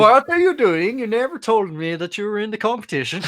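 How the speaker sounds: put-on overdone Swedish movie accent; bad Swedish accent. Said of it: "The worst Swedish accent I've ever heard".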